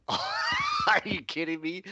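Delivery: high laughing shout, slow; laughing disbelief. Real, not acted. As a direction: laugh out the protest. Teased about drinking coffee.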